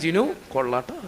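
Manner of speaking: low, soft pat on his own back; self-praise. Retold or imitated. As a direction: tell yourself quietly that you did well. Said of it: "ഞാൻ തന്നെ ഇടയ്ക്ക് പറയാറുണ്ട്".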